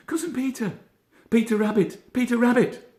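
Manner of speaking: high, loud calling out; shouting. A narrator's reading. Benjamin calling to his cousin, high loud shout. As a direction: call out across the meadow, high and loud. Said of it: "shouted Benjamin Bunny".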